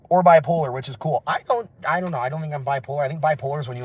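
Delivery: ordinary talk, nothing marked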